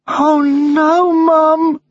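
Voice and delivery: high, loud wail of dismay; dismayed boy. Acted. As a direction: cry out to your mother in a child's voice. Boy hears pet is missing.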